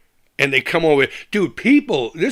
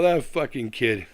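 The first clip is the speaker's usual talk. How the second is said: normal talking voice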